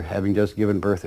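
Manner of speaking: ordinary delivery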